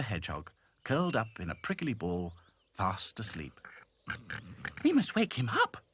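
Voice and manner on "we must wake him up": high and bright little princess. Acted. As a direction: squeak it like a tiny princess. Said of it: "said Princess".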